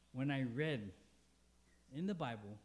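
normal talking voice

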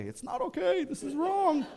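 mock outrage, voice jumping way up; indignant retort. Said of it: "Dad, it's okay".

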